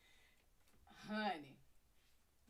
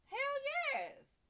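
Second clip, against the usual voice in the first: much higher, triumphant; proud exclaim. Retold or imitated. Answer the rivals, high and triumphant. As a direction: brag it out.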